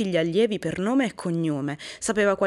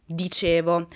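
usual speaking voice

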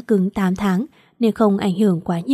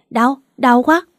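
a higher, louder cry of pain; 4 semitones higher, 5 dB louder, slower than usual. Acted. Injured wife cries out in pain, higher and louder. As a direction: call out that it hurts. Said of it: "Vi la lên".

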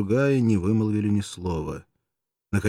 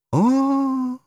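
high, loud dramatic "oh!"; theatrical high exclamation; exclaimed "oh"; 5 dB louder than usual. A narrator's reading. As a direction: cry out to the heavens. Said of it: "воскликнула немного погодя девушка".

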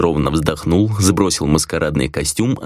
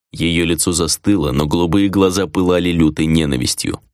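ordinary delivery

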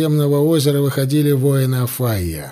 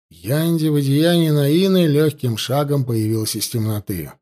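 ordinary delivery

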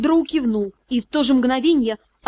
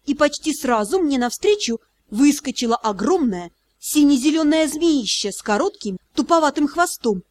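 ordinary delivery, nothing marked

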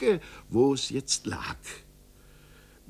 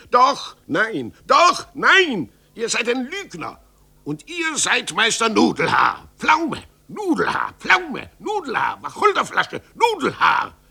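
mocking taunts, far higher and louder; shrill schoolyard teasing in a fight; taunting name-calling; 10 semitones higher, 7 dB louder than usual. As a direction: jeer the nickname at him.

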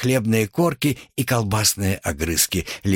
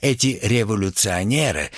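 usual speaking voice

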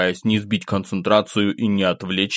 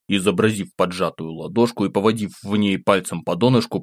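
plain reading voice

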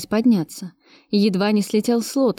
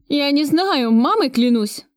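a touch higher, whining plea; 6 semitones higher, slower than usual. Acted. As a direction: whine like a scared thug swearing on his mother. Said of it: "заныл бандит".

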